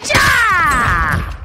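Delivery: excited cry. Real, not acted. Kill celebration cry.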